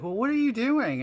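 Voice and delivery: high, loud disbelieving question; loud, high incredulous what. Retold or imitated. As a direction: ask it like you can't believe it.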